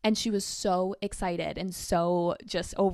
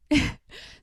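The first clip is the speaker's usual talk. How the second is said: a short airy giggle; breathy giggle; 4 dB louder than usual. Real, not acted. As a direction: let a breathy laugh slip out. Laughs after teasing.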